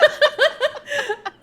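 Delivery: shrieking laugh; high laugh. Real, not acted. Laughing outburst.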